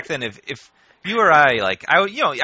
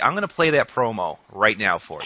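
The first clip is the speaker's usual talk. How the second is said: whisper; 4 semitones lower than usual. Real, not acted. Whispered lead-in to clip.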